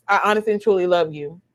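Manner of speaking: ordinary talk, nothing marked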